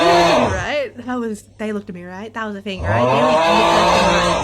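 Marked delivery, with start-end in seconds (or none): 0.0-0.6 shouted much louder and lower; loud shout at a creepy figure; loud spooked shout. Real, not acted. Shouted while spooked in the game. 2.8-4.4 slow, much louder, stretched out; slow loud spooked wail; drawn-out loud cry. Real, not acted.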